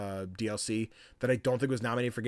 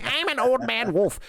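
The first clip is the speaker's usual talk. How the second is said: slow, much higher put-on old wolf voice; old man wolf; 11 semitones higher, 5 dB louder than usual. Gamer mocking a game's old wolf boss, slow high put-on voice. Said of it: "He's like".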